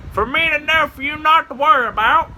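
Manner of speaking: high, teasing disguised voice; put-on voice. Hidden prankster dodging the cops, high teasing voice. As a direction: answer in a cagey high voice. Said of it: "when you can do multiple voices".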